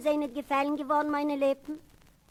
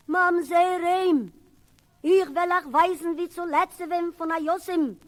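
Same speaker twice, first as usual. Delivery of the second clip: mother calling; 4 semitones higher, 3 dB louder than usual. Retold or imitated. Mother calls the boy in.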